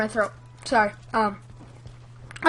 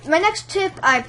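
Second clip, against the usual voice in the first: ordinary talk, nothing marked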